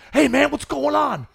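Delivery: drawn-out, high-pitched playful holler; calling out. Retold or imitated. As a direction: call out to a kid running past, high and slow. Jokingly calling to running kid.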